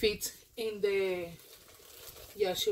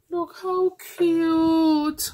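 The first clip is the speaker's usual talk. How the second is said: high, loud, slow gushing; gushing over something adorable; cooing delight; 7 semitones higher, 7 dB louder, much slower than usual. Real, not acted. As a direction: stretch every word in delight.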